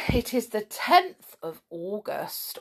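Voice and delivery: normal talking voice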